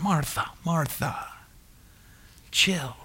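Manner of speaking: soft gentle call, a little pleading; Jesus calling Martha down gently, quiet and pleading. Acted. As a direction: soothe her, not scold. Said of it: "a gentle thing".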